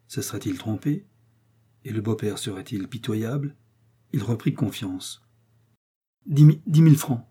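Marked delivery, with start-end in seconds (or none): 6.2-7.2 louder, higher, stammered answer; stammering. A narrator's reading. Son-in-law naming the sum he needs, stammering. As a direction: stumble and restart on the first words. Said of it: "balbutia-t-il".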